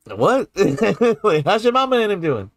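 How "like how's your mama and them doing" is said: New Orleans accent. Shown as a demonstration. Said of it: "this kind of stuff comes out of me more".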